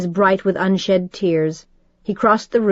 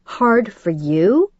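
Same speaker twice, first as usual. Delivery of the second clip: bitter disbelief; 2 semitones higher, much slower than usual. A narrator's reading. Bitter retort to father.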